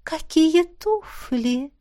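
very slow, high, flirty coo; cartoon princess. A narrator's reading. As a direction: gush over him coquettishly. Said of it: "кокетливо прощебетала Мисси".

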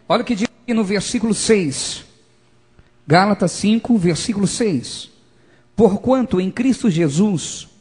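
loud, emphatic verse reading; preacher declaiming scripture. Real, not acted. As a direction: proclaim each phrase at full force.

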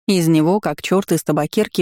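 plain reading voice

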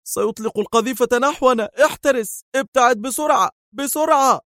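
very high, urgent warning; frantic high-pitched alarm; alarmed cry. A narrator's reading. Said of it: "فهتف".

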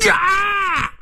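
very high, drawn-out battle cry; high scream thrown behind a kick; attack yell. Acted. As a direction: yell as you lunge to strike. Old Jia attacks with a kick.

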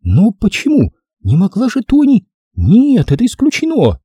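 high-pitched incredulous woman; shocked lady refusing to believe; stunned disbelief. Acted. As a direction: protest in high female disbelief. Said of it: "Она оторопела".

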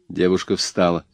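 plain reading voice

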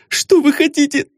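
put-on high, startled girl's answer; high startled denial; frightened young lady. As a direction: blurt it out like a scared young lady. Frightened girl answers detective.

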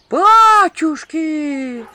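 slow, high, loud horrified cry; drawn-out horrified exclamation; horrified gasp. Acted. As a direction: gasp out in horror, stretched. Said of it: "ужаснулся третий женский голос".

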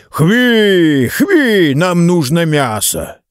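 put-on a drunk hooting for meat; drunk rowdy. A narrator's reading. Drunk man rousing his friends. (пьяный выкрик, выше и громче)

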